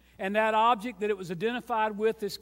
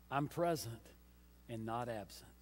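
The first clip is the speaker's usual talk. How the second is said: much softer, low and gentle; soft tender voice of God; 12 semitones lower, 14 dB quieter than usual. Acted. God speaking to the strugglers, soft and low. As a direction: lay it down gently. God's words, dropping soft.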